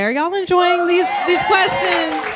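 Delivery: calling out. Real, not acted. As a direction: call out to the panel. Host calls out to panel.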